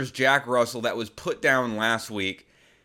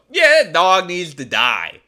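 loud, casual death sentence; blunt callous visitor; 4 semitones higher, 9 dB louder than usual. Retold or imitated. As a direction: toss it off loudly like it's nothing. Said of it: "walk into your house and go".

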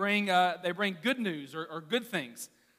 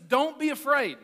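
raised, higher angel line; angel's reassurance; 8 semitones higher, 6 dB louder than usual. Acted. Angel telling the shepherds, raised and higher. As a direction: proclaim it like the angel. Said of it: "so the angel says".

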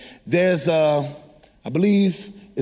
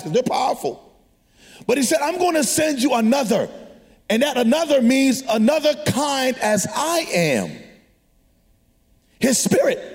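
preaching; 7 semitones higher than usual. Acted. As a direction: preach it with rising fire. Long preached passage.

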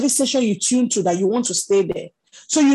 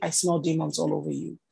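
lower, much softer mock disclosure; understated example; 5 semitones lower, 8 dB quieter than usual. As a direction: say it low, under your voice. Acting blunt message not to say.

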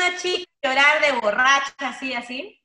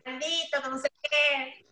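imitating drunk crying; 3 semitones higher, 7 dB quieter than usual. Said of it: "y yo lloraba así".

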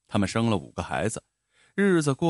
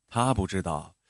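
ordinary delivery

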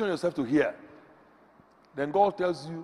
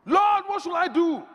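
slow, higher, louder earnest plea; prayer of inquiry; 11 semitones higher, 5 dB louder, slower than usual. Shown as a demonstration. Preacher demonstrating how to ask God for direction, slow earnest plea. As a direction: ask God humbly but fervently. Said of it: "Prayer of inquiry".

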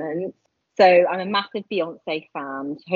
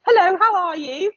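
high-pitched put-on Gloucestershire greeting; mock Gloucestershire hello, pitched way up; Gloucestershire accent; 12 semitones higher, 3 dB louder than usual. Shown as a demonstration. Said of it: "it's kind of like a".

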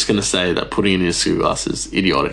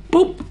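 squeaky high boop as the seed pops; high boop sound. Acted. Ranting about avocado hacks, then a squeaky high boop. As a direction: pop the seed out with a tiny squeak.

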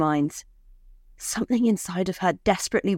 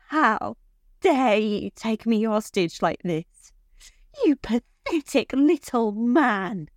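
higher-pitched, heated indignation; indignant anger; 5 semitones higher, slower than usual. A narrator's reading. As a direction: confront a captor, outraged, pitch raised. Furious woman confronts her captor.

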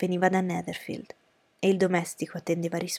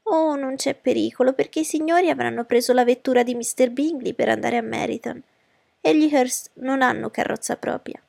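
high, lively mother's voice; fussy mother, dismissive; 5 semitones higher, 4 dB louder than usual. Acted. Mother dismissing her daughters' worry, high and fussy. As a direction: brush the objection aside, pitched high. Voices Mrs. Bennet in the story.

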